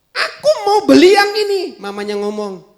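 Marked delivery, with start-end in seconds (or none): 0.1-1.6 put-on demanding child.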